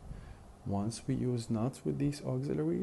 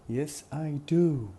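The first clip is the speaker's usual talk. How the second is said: slow, loud, higher, landing hard on "do"; strong stressed "do"; 5 semitones higher, 9 dB louder, slower than usual. Shown as a demonstration. Teacher modelling a short answer for learners, strong stressed "do". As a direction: hit "do" long and full at the end.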